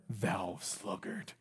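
soft, slow, savoring "Thou sluggard" with a rise; relished repeat. Shown as a demonstration. Preacher quietly savoring the phrase he loves, slow and soft. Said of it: "I love that".

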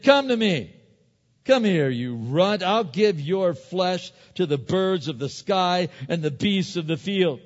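high, loud, slow threats; taunting giant. Retold or imitated. Goliath taunting young David, loud and high. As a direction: roar down at the boy like Goliath. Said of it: "this Philistine said to David".

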